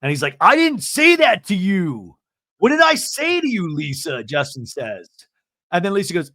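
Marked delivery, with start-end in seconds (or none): 0.4-4.2 loud, high, indignant mock yell; retold angry protest. Justin protesting to Lisa, in a mock-angry shout. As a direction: hammer out an acted protest.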